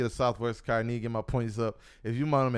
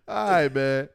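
ordinary delivery

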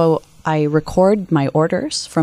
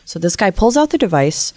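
normal talking voice

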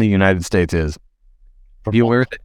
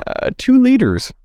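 mock surprise; slower than usual. Reading a law, mock surprise.

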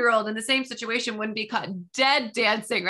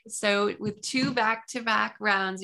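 normal talking voice

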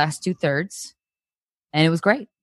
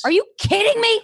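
much higher, outraged disbelief; 3 dB louder than usual. Acted. Susan, disgusted with Dan, outraged shriek.

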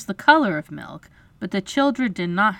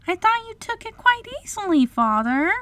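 put-on very high girlish wondering; puzzled little girl; 14 semitones higher than usual. A narrator's reading. Wendy questions her father, puzzled. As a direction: ask innocently in a high voice. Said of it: "Wendy was quite puzzled".

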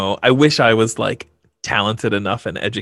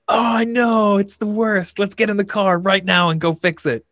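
higher, hammed-up eager dad; mock eager parent; 9 semitones higher than usual. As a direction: overplay the enthusiastic father. Mocking himself as overeager parent.

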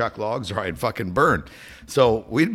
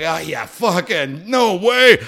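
stunned disbelief; 8 semitones higher, 5 dB louder, much slower than usual. Retold or imitated. Disbelief at the fire.

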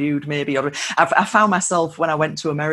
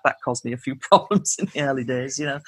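ordinary delivery

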